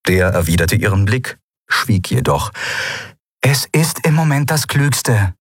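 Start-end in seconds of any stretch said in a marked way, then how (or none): 3.4-5.3 firm, high-pitched verdict; decided, raised female character voice; closing the discussion. A narrator's reading. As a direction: end the argument firmly, voice pitched high. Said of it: "bemüht, die Diskussion zu beenden".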